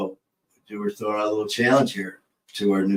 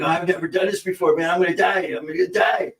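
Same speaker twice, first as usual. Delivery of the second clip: higher, fast, hammed-up panic; mock panic; 7 semitones higher, faster than usual. Retelling the Cheech and Chong acid scene, mock panicked rush. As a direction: play the freaked-out stoner, rushing. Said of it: "He's like".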